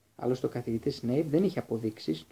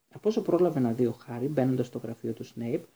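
plain reading voice

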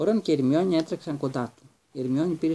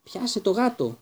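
yelled; 8 semitones higher, 3 dB louder than usual. A narrator's reading. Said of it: "του ούρλιαξε ο Ρον".

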